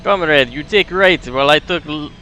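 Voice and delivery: put-on light Russian accent with broken grammar; Soviet accent. Grumpnik reading the chart for comrade, light Russian accent. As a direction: stay in the comrade role. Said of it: "Soviet sound voice".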